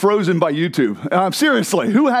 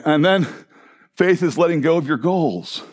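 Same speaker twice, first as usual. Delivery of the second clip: normal talking voice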